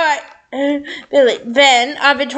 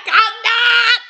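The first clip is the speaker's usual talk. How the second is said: higher-pitched shouted retort; yelling back; 6 semitones higher than usual. A narrator's reading. Anastasia answering Zachary, yelling back. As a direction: holler a short denial back. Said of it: "Anastasia also yelling back".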